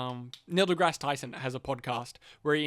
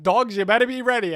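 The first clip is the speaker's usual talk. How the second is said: loud, higher mock warning; raised-voice game war cry; retold rallying shout; 7 semitones higher, 8 dB louder than usual. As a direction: call it out to the pack. Retold battle call to the dogs.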